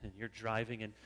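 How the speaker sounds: ordinary talk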